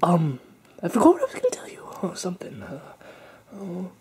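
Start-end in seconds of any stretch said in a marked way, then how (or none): 0.8-2.6 whispering. Acted. Whispered forgetting.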